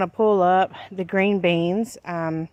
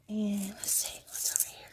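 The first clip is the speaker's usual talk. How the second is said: whispering; 2 semitones higher, 12 dB quieter than usual. Real, not acted. Checking garlic rows closely.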